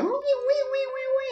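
high, slow wavering uy like a siren; siren imitation. Sister retelling the ambulance arriving, imitating the siren.

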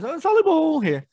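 meme quote. Retold or imitated. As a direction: push it up high like the kid. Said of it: "he goes".

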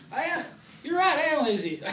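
high, loud comic confession; mock self-reproach. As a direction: wail your agreement, hammed up. Acts agreeing with the enemy's accusation.

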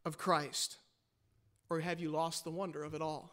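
quiet, intimate sermon appeal; hushed reflection. Acted. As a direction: draw them in with a near-hush.

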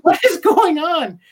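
high, loud, incredulous cry; bewildered outburst. Retold or imitated. Reacting to a baffling movie scene, a high, loud bewildered cry. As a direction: throw up your hands in disbelief. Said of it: "I'm like".

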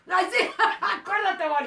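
high, loud, through laughter; excited urging with a real laugh; laughing plea. Real, not acted. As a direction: egg her on while laughing. Begs co-host, laughing.

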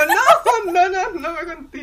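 high squealing quote, half laughing; excited squeal. Retold or imitated. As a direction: squeal it like you can't believe it. Friend's excited reaction acted out. (chillido emocionado medio riendo)

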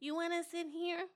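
lightly teasing high imitation; imitating friend's offer. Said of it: "That's how I sounded?".

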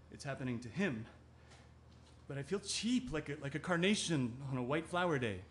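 ordinary talk, nothing marked

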